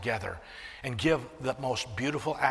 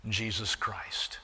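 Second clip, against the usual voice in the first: reverent whisper; 4 semitones lower, 5 dB quieter, slower than usual. Real, not acted. Reverent naming of Jesus.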